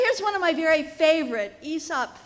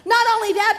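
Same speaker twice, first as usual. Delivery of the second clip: indignant; 7 semitones higher, 8 dB louder, faster than usual. Acted. Dog complains.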